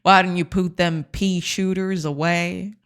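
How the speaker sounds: slow put-on western cowboy; lightly voiced old west gunslinger. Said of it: "that's kinda what I'm sounding right now".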